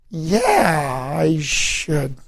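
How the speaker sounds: long, stretched-out words in a retold reply; drawn-out agreement. Man answering her invitation to church, slowly drawn-out agreement. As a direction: draw each word out slowly, as if taken with the idea.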